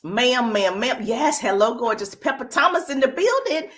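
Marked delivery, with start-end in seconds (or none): 2.1-3.7 higher-pitched hype greeting; excited shout-out. Real, not acted. Livestream host hails a viewer joining, excited shout-out. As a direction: announce an arrival with a lift. Hailing a viewer who joins.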